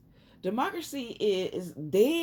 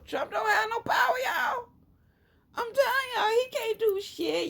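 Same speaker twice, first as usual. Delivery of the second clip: pitched way up, crying out in exasperation; high exasperated outcry; 13 semitones higher than usual. Real, not acted. To her viewers about Trump, a high exasperated outcry. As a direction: throw it up high like you can't believe they don't see it.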